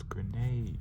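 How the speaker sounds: loud, high warning cry; yelled warning. A narrator's reading. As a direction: yell an urgent warning, stretching the end. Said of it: "she yells".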